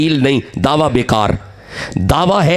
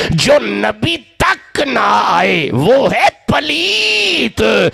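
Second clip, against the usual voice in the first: drawn-out, ringing cry from the pulpit; shouted preaching; 6 semitones higher, much slower than usual. Acted. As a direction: belt it to the back of the hall, slow and high. Climax line shouted to the gathering.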